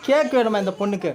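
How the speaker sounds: normal talking voice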